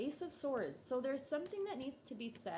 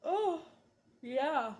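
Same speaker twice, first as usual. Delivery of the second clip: yawning; 6 semitones higher, 8 dB louder than usual. Real, not acted. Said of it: "Like, I just started yawning".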